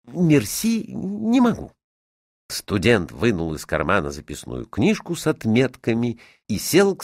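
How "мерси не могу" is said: high, halting thanks; polite refusal. A narrator's reading. Student declines the hostess's currants, awkwardly polite.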